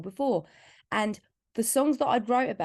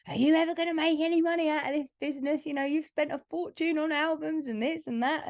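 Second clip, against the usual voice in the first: higher, faster mock worried father; quick skeptical nag, pitched up; doubting dad; 7 semitones higher than usual. Dad questioning his daughter's music spending, mock worried voice. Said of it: "my dad says to me".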